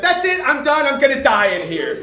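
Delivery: high, loud hammed-up despair; mock despair. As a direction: wail it like you're doomed. Retelling his despair.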